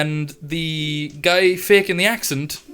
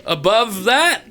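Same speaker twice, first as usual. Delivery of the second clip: announcer voice; 7 semitones higher, slower than usual. Acted. GM announcing placings.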